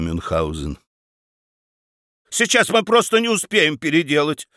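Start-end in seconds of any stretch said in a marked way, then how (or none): 2.3-4.5 выше, с резкой вспышкой раздражения; раздражённо выпалить; вспыхнула. A narrator's reading. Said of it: "вспыхнула баронесса". (баронесса вспыхивает на мужа: выше и резко)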